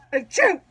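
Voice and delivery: quick burp that breaks in mid-sentence; an involuntary burp. Real, not acted. Woman burping during her church testimony, sudden burp. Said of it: "these burps that came on".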